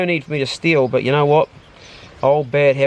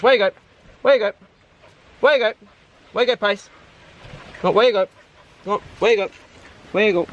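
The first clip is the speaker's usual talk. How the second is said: calling the dog; 6 semitones higher, faster than usual. Real, not acted. Repeatedly calling the dog over.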